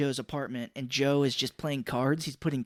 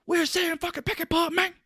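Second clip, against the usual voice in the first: much higher, strained mock Cage outburst; high-pitched impression of an unhinged gangster; manic Nicolas Cage yell. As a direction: ham up a crazed actor line. Imitating Nicolas Cage's crazed line.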